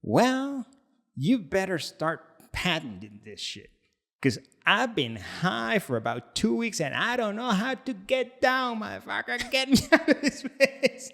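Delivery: imitating a wild caller with a laugh; mock frantic rant. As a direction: plead and curse like a friend stuck being high. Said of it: "he was angry".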